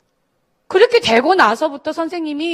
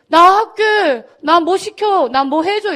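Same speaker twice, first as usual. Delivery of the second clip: put-on eager kids; 6 semitones higher, 5 dB louder, much slower than usual.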